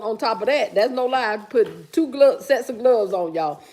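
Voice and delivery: ordinary talk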